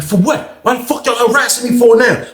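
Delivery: high, indignant snap; snapping. Retold or imitated. Inmate protesting to the officers, high indignant snapping. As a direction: protest the harassment, voice jumping up. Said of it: "He snapping like that".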